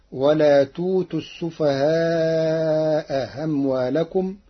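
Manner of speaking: السفهاء with the second hamza softened, then lengthened; Warsh reading. Shown as a demonstration. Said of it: "بتسهيل الهمزة الثانية، ثم إبدالها ألفا".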